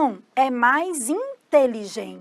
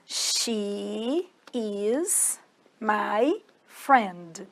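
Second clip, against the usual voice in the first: extremely slow, word by word, lower; slow demonstration; 3 semitones lower, 7 dB quieter, much slower than usual. Shown as a demonstration. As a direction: stretch each word out with pauses. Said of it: "vou falar pra vocês como que fala".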